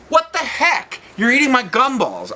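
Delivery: high, loud indignant squeal; high-pitched outraged kid. Retold or imitated. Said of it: "I was like".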